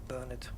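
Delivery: "Bernard" as BER-nud; first-syllable stress. Shown as a demonstration. Host settling on the British way, "Bernard" as BER-nud. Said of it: "emphasis on the first syllable".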